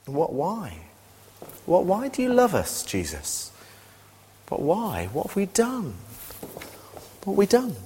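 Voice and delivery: acted disbelief, pitch leaping on key words; astonished questioning. Preacher asking Jesus why he loves us, astonished. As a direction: ask it in bewildered wonder. Acting out shock at being loved.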